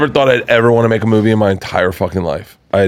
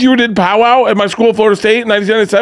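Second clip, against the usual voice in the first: panicking; 12 semitones higher than usual. Retold or imitated. Fan babbling a nervous college story at Adam Sandler, high and fast. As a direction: gush nervously at a rush. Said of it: "I'm fucking panicking".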